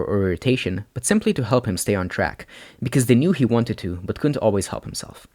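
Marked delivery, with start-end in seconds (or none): none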